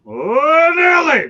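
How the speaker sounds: high, loud drawn-out "Oh, Nelly"; Keith Jackson. Retold or imitated. Host borrowing a broadcaster's catchphrase, loud and high. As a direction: do the old play-by-play catchphrase. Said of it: "Keith Jackson would say".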